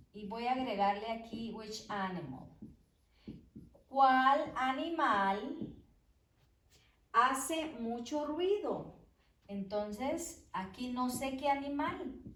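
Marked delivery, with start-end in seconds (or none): 3.9-9.0 slow and loud, each word set apart for the class; slow dictation. Shown as a demonstration. Teacher dictating an example question to the class, slow and loud. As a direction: dictate it clearly to students.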